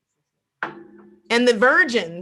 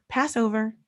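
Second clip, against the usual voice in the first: usual speaking voice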